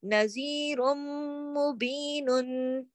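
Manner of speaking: "नज़ीरुम मुबीनुन" slowly, long nasal m, final "nun" sounded; ghunnah recitation. Shown as a demonstration. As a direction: draw out "नज़ीरुम मुबीनुन" through to its last syllable. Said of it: "गुन्ना भी हो रहा".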